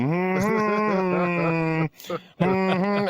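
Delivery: long held hum, building up. Shown as a demonstration. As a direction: demonstrate a hum that swells and rises. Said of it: "and you get louder".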